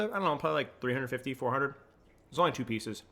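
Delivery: talking through a mouthful of pizza; chewing while answering, mouth full. Real, not acted. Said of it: "everyone loves when I talk with my mouth full".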